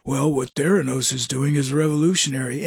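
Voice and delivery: low, put-on CEO gravitas; fake deep Holmes voice. Imitating Elizabeth Holmes pitching Theranos, low put-on voice. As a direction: drop the pitch to sound like a visionary. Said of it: "she would talk like".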